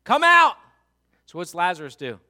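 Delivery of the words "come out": much higher, loud calling out; loud command. Acted. Jesus calling the dead man from the tomb, loud cry. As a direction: call him out of the tomb with full voice. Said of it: "he cried out with a loud voice".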